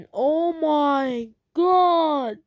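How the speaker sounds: loud, dragged-out dismay; exasperated. Real, not acted. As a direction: groan it out slowly, each word stretched in disbelief. Exasperated rant at a hater.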